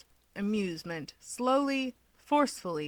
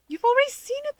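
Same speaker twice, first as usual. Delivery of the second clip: much higher, louder chirpy retort; breezy Keigo; 5 dB louder, faster than usual. Acted. Keigo answering Toya, chirpy and higher. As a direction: toss it back brightly.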